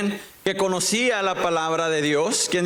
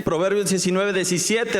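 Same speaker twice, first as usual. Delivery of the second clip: ordinary delivery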